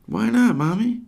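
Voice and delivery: put-on high, pleading little girl voice; child asking. Sage asking her mother why not, in a high plea. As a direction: look up and plead with mom. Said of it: "Sage asks up to her".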